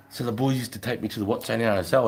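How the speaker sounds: usual speaking voice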